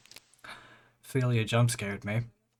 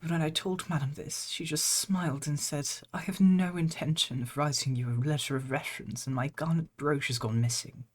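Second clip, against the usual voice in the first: higher, gentle maid reading; maid character voice; 6 semitones higher than usual. Acted. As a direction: voice the weary maid, raised pitch. Reads maid's game text.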